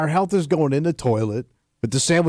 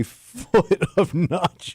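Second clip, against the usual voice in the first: slow, drawn out through laughter; words stretched by a laugh; laughing while talking; 2 semitones higher, much slower than usual. Real, not acted. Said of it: "I don't know why you're laughing".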